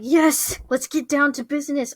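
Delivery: fired up, raise pitch and volume; hyped-up quote. Retold or imitated. Acting hyped by the song.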